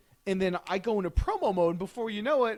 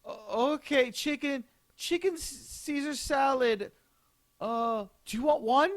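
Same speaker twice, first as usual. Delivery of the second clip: a much higher clerk voice, hesitant; confused clerk; 9 semitones higher than usual. Retold or imitated. Clerk fumbling the order back, high hesitant voice. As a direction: stumble like a confused cashier. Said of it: "clerk repeating order".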